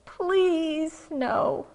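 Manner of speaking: high, loud mock pleading; Calvin, gritted teeth. Cartoon kid begging the sky for snow, strained mock plea. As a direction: beg through gritted teeth, stretching each please. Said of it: "gritting his teeth and he says".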